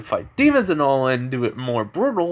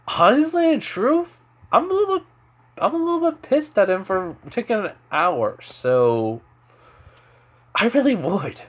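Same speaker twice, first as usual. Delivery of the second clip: high annoyed voice; 9 semitones higher than usual. Acted. Annoyed Kakashi admits he's pissed.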